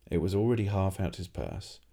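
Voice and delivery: ordinary delivery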